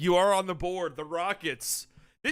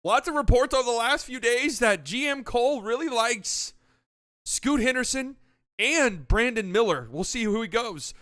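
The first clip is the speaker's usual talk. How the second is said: higher, louder hyped-up draft host; draft broadcast host; 4 semitones higher, 4 dB louder than usual. Pretend. Hosting the mock draft for friends, hyped broadcaster. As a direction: sell each pick like live TV.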